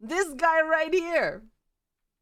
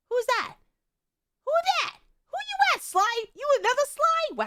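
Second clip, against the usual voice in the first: much higher, startled disbelief; shocked high squeak; 12 semitones higher than usual. Real, not acted. Streamer startled by another Sly, high squeak. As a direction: squeak in surprise.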